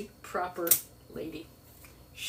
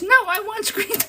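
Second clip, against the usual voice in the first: mother's demand; 12 semitones higher, 7 dB louder than usual. Retold or imitated. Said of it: "She goes".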